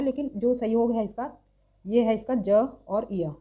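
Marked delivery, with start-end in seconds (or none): none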